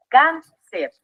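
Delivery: "Cáncer" en dos sílabas sueltas, cán y cer; separado en sílabas. Shown as a demonstration. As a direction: divide "Cáncer" con una pausa entre sílabas. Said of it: "Vamos a dividirla en sílabas". ("Cáncer" silabeado para enseñar)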